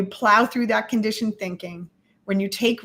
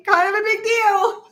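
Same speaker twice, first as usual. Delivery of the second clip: higher, singsong repeat of the phrase; playful teasing; 9 semitones higher than usual. Real, not acted. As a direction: tease him, pitching it up playfully. Teasing the guest.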